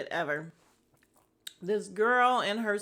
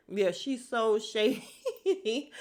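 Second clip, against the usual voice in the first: slow, laughing through the words; slow amused laugh on shady; much slower than usual. Real, not acted. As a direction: drag out shady while laughing. Gossiping about a cast member.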